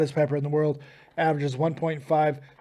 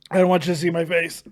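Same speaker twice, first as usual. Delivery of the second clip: ordinary talk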